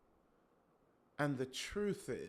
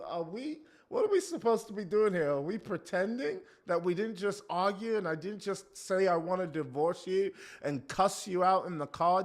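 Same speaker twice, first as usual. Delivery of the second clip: louder, disbelieving husband; indignant husband; 4 semitones higher, 8 dB louder than usual. Retold or imitated. Husband baffled by his wife's pretense, raising his voice. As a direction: protest like the husband who can't believe the act.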